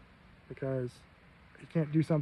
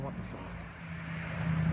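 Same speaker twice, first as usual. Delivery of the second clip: shocked whisper; 6 dB quieter, much slower than usual. Retold or imitated. Therapist shocked at weak arm.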